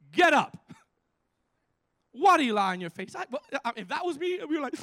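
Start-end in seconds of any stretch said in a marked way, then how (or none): 0.1-3.2 loud, forceful divine order; booming rebuke from God, acted out; stern command. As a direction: order someone to their feet, loudly. God commanding weeping Joshua to rise.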